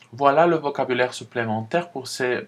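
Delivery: usual speaking voice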